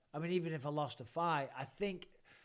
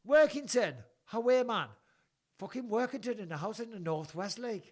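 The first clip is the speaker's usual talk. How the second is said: put-on high, loud northern English accent; Workington accent; 6 semitones higher, 6 dB louder than usual. Host shouting out Workington, in a high northern accent. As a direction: call it out in broad Workington dialect.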